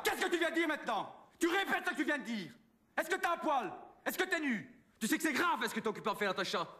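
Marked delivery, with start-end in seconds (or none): 0.0-5.6 higher, indignant and hammed-up; indignant outburst. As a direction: bristle at the accusation, pitch climbing. Accusé de photographier des adolescentes. (photographe indigné qui hausse le ton)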